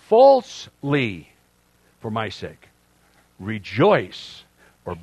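loud, deliberate Bible reading; scripture reading. Acted. Pastor reading the Beatitudes aloud to the church, emphatic. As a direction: proclaim each verse.

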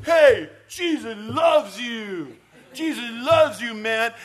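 put-on loud, high, drawn-out drunken yell; drunk friend shouting. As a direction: holler it drunkenly at everyone on the street. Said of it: "it's my drunk friend".

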